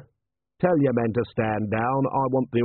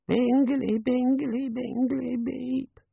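high, fading little device chirp; gadget voice; much slower than usual. A narrator's reading. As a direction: sing out like a tiny sleepy gadget.

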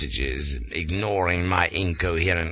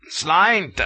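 grunted toast; 10 semitones higher, 4 dB louder than usual. A narrator's reading. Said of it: "For all he grunted".

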